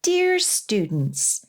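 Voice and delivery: ordinary delivery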